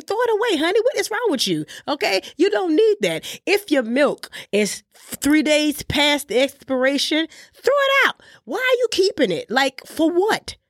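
high-pitched, incredulous telling-off; exasperated scolding. Real, not acted. Exasperated scolding of food hoarders.

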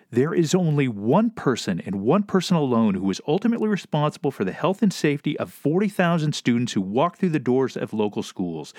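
usual speaking voice

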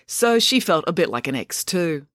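ordinary delivery

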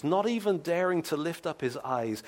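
ordinary delivery

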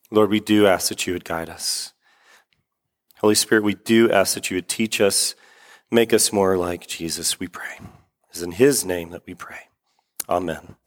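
low, subdued congregational prayer; hushed, humble petition. Real, not acted. Leads a prayer.

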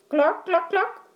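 high squawky clucks; hen clucking. A narrator's reading. Hen voiced in story.

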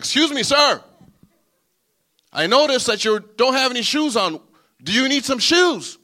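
high, loud polite hail; calling out. Acted. Driver hailing a barefoot man from his window, high and loud. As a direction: call out to a stranger from the car.

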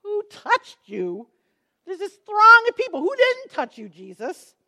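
incredulous, eye-rolling, high and loud; mock the question like it's absurd; exasperated disbelief. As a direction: disciples scoffing at Jesus, loud and high. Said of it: "probably rolling their eyes".

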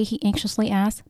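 plain reading voice

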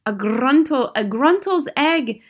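rising crowd exclamation; crying out. Acted. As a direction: exclaim it back as a startled witch audience. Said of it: "cried the audience".

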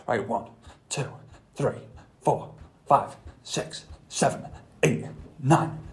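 breathless counting during jumping jacks; out of breath. Real, not acted. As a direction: count while doing star jumps, puffing. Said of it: "now, my heart is pounding".

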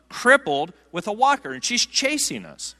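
usual speaking voice

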